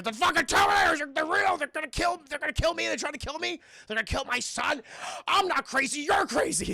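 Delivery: high, loud frantic screaming; hammed-up hysterical rant, voice way up; ranting, raving. Sarah Connor on the tape screams at her doctors, frantic raving. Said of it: "it's her ranting, raving".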